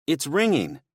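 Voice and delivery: bright eager high voice; excitedly announcing the ring. A narrator's reading. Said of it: "he said excitedly".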